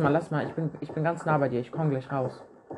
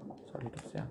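teasing whisper; 3 semitones lower, 16 dB quieter than usual. Real, not acted. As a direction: whisper it with a smirk. Teasing fellow player about prison. (geflüstert)

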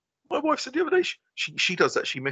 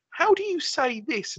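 high, slow wife voice; wife asking; 12 semitones higher, slower than usual. Retold or imitated. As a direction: ask slowly, pitched well up. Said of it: "She comes to me and says".

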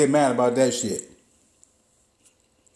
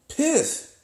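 outraged disbelief; 13 semitones higher than usual. Retold or imitated. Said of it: "I thought to myself".

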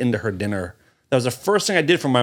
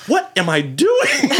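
high, slow mock wail; aghast at your own stupidity, hammed up; comic high-pitched self-reproach; horrified disbelief; 11 semitones higher, slower than usual. Horrified at himself, retold.

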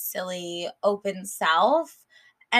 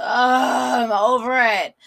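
fed-up whine; 4 semitones higher, 9 dB louder, much slower than usual. Real, not acted. Fed up with dating.

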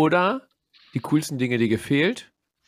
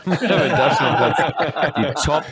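slow, louder, laughing; bursting out laughing; 4 semitones higher, 5 dB louder, much slower than usual. Real, not acted. Lacht über Salis Platz drei. (lachend, langsam)

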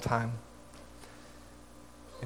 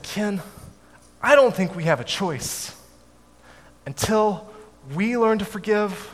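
a lifted, pleading grandmother voice, raised high; grandmother's earnest plea; 8 semitones higher than usual. Retold or imitated. Grandmother tells her son Ken they must forgive, raised and earnest. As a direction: reach up in pitch as a gentle but insistent grandmother.

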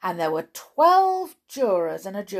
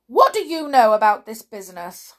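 loud royal interrogation; questioning king; 4 semitones higher, 11 dB louder than usual. A narrator's reading. King questioning Alice as a witness, loud demand. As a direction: demand it loudly and firmly. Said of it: "the king said to Alice".

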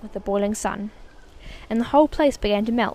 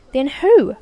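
put-on a louder, eager cry from a curious boy; cried; 4 semitones higher, 8 dB louder than usual. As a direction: blurt it out, impatient to know. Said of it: "cried Charlie".

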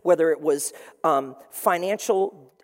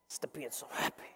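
grumpy whisper; 6 semitones lower, 17 dB quieter than usual. Retold or imitated. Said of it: "you're in a bad mood".

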